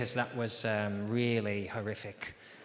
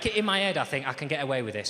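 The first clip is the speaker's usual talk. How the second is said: usual speaking voice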